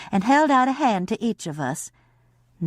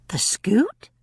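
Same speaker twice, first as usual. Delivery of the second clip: whispered question; 4 semitones higher than usual. Acted. Kate asks in disbelief.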